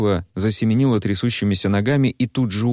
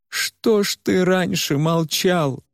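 much higher, slow, bitter reproach; Варя reproaching; 11 semitones higher, slower than usual. Acted. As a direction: hurl it at him. Varya reproaches Куйгарош. (высокий, медленный упрёк Вари)

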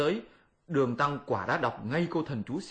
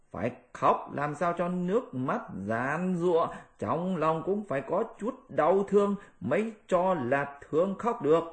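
high mocking monkey voice; high Monkey King, mock lesson; Monkey King lecturing; 3 semitones higher than usual. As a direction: teach the pig how to wail.

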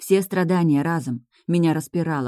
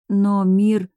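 raised, loud plaintive moan; 5 semitones higher, 5 dB louder than usual. A narrator's reading. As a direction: moan in protest from exhaustion. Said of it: "простонала я". (жалобный стон)

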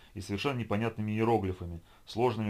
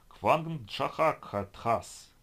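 very slow, higher, strange voice for made-up words; alien gibberish; 5 semitones higher, much slower than usual. Acted. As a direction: drag the alien syllables out like an incantation.

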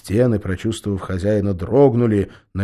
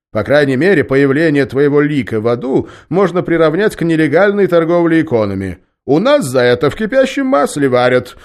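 loud, higher menacing demon; threatening devil; 9 semitones higher, 10 dB louder than usual. A narrator's reading. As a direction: threaten like the lord of hell.

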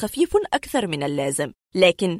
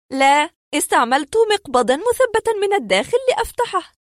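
higher-pitched woman's voice recounting an uncanny experience; distressed woman patient; 11 semitones higher, 4 dB louder than usual. Acted. Woman tells doctors of the strange door, high earnest voice. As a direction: play the patient pleading her strange story.

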